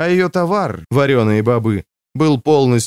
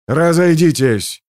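drawn-out, raised-pitch shout to a crowd; yelled order; 5 semitones higher, 4 dB louder, slower than usual. A narrator's reading. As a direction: call out a slow, forceful command. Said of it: "заорал он".

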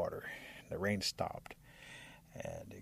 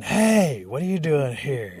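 loud, high indignant challenge; indignant shout; 5 semitones higher, 11 dB louder, slower than usual. Acted. Animals confronting Rabbit at the front, indignant shout. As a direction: call out the queue-jumper.

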